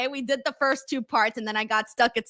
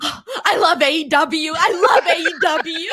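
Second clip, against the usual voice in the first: raised pitch, louder, building to a laugh; imitated gushing fan; 5 semitones higher, 6 dB louder than usual. Guy after every cool spot, imitated excited cry. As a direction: gush it loudly like an overjoyed fan. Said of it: "the guy would go".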